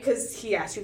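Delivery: usual speaking voice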